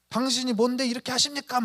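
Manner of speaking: acted confrontation. Wronged person confronts the offender, indignantly. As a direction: act out talking back in anger. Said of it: "막 이러면서 맞서 싸우잖아요".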